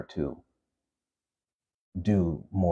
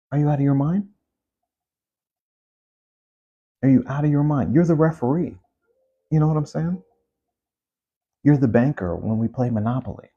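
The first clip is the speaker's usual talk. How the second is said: higher and louder, in disbelief; really can't believe it, let it burst out; exasperated outburst; 8 semitones higher, 5 dB louder than usual. Real, not acted. Vlogger ranting at billionaires, higher, louder, incredulous.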